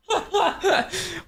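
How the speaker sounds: short high burst of laughter. Real, not acted. Laughs at the silly questions.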